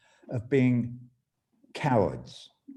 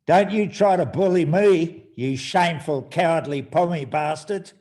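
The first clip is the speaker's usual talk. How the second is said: louder, higher angry retort; angry outburst; 7 semitones higher, 10 dB louder than usual. Acted. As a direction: snap back at him like an offended bloke. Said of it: "says the Aussie".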